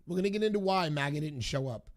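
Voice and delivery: usual speaking voice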